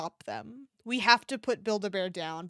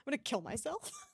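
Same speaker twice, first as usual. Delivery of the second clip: laughing; 5 semitones higher, 9 dB quieter than usual. Real, not acted. Laughing at cute toy.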